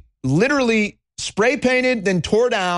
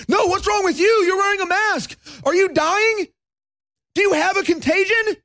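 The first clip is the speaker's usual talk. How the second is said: high, frantic put-on hysteria; mock basket case; 13 semitones higher than usual. Host turning on a masked stranger, mock hysteria. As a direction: fall apart in exaggerated panic. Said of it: "become like a basket case".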